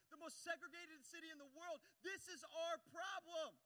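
slow, high, loud insistence; impassioned preaching. Real, not acted. Preacher urging church to own city's problem, impassioned. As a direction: drive it home to the whole congregation.

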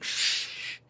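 put-on soft drunken shush; imitated shushing. Drunk friend hushing the car before ordering, imitated shush. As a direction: hush everyone, all breath.

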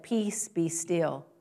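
slow, soft calming command. Acted. Jesus speaking to the storm, slow and calm. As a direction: still the storm gently.

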